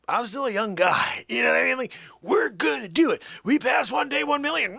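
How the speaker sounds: excited shout. Real, not acted. Streamer hyping day one million.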